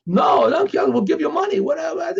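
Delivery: high, shouted begging for mercy; panicked pleading. Retold or imitated. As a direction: beg for your life in a panic. Captives begging owner for their lives.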